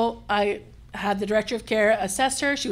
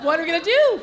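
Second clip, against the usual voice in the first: mock panic; 7 semitones higher, 5 dB louder than usual. Mock panic in retold scene.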